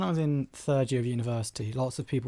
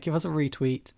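ordinary talk, nothing marked

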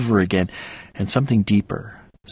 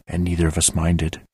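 ordinary delivery

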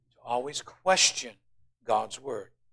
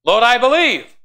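loud and high, crying out; loud cry of faith; 10 semitones higher, 12 dB louder than usual. Acted. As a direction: cry it out to God. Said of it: "It's kind of an oxymoron there".

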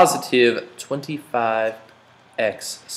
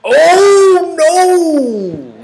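mock horror; 8 dB louder than usual. Student suggests illegal expansion.

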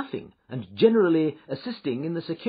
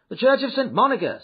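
raised, loud instruction to a driver; called-out order; 8 semitones higher, 6 dB louder than usual. A narrator's reading. Passenger jumping into a cab, calling out. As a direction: call it out briskly, pitched high.

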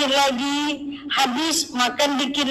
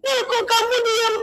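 higher-pitched mock pleading; acted-out plaintive nagging; complaining friend; 10 semitones higher than usual. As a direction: imitate a friend nagging for an answer. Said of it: "banyak kali nih keluhannya".

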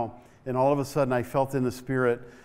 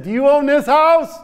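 higher, louder question; spoke up louder; 13 semitones higher, 11 dB louder than usual. Retold or imitated. Preacher asking a deaf old man, louder. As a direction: raise the voice so he hears. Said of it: "So I spoke up a little louder".

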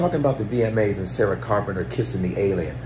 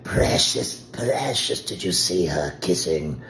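hissing, breathy Gollum whisper; mock Gollum hiss; 3 semitones lower, 4 dB quieter, slower than usual. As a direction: croak it like Gollum. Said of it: "I thought she was Gollum".

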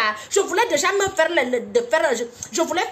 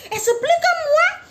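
much higher, louder, drawn out; exasperated demand; 13 semitones higher, 4 dB louder, slower than usual. Retold or imitated. Host losing patience with a rambling caller, high and loud. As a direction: fling it at him, fed up.

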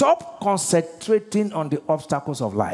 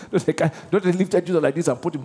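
usual speaking voice

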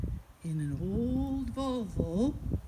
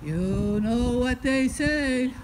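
ominous warning; 3 dB louder than usual. Acted. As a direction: stretch the words out as a heavy caution. Husband repeats his warning to wife.

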